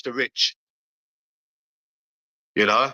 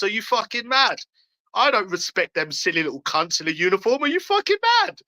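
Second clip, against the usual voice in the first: real angry rant climbing higher into a shrieking peak; furious outburst; 10 semitones higher than usual. Real, not acted. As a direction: let the rage spike higher on each "are you fucking mad". Furious rant about police.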